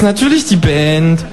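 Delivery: high, slow deadpan kangaroo; dry kangaroo. Acted. Kangaroo mocking a silly question, high and slow. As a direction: drag it out, sarcastic.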